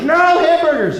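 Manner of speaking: high, loud mock kid yell. Kids shouting from the back seat, loud and high. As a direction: bawl it like a cranky child in the back seat. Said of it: "they're all yelling".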